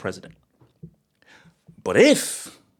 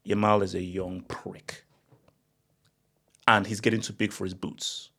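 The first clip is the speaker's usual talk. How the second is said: a hint of a rival's put-down; imagined Bellingham jibe; 4 semitones lower, 6 dB quieter than usual. Pundit voicing Bellingham's jibe, softly sneering. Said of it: "I want Bellingham to say".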